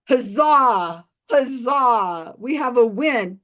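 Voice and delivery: mock triumphant cheer. YouTuber mockingly celebrates the psychic's win, triumphant. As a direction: hail it like a silly victory.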